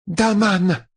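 high-pitched imperious cry; Tuon crying out. A narrator's reading. Empress cuts Matt off, crying out. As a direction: cry it out high, cutting someone off. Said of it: "s'écria-t-elle".

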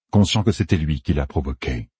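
usual speaking voice

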